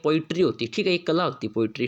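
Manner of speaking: ordinary talk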